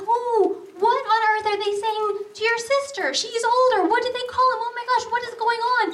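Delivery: high-pitched alarmed questioning; cringing mother. Retold or imitated. Mom worried about what her daughter hears, cringing. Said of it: "Which made me cringe".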